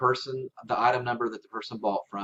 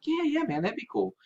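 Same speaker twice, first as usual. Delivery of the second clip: imitating a buyer, pitched much higher and casual; easygoing buyer; 11 semitones higher than usual. Buyer agreeing to a discount, much higher and breezy. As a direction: play the happy customer agreeing to a discount. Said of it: "they'll be like".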